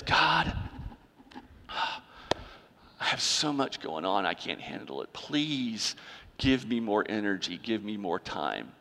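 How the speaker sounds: hushed, drained, pleading voice; weary prayer. Retold or imitated. Preacher mimics his own worn-out prayer, hushed and drained. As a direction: sag into a tired, quiet plea to God.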